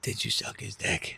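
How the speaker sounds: slow, near-whispered asking; acted hesitant whisper; hushed question. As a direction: barely dare to ask it, drawn out softly. Retold hesitant, dreaded question.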